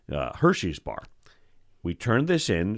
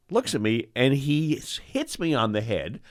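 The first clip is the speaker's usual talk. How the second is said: usual speaking voice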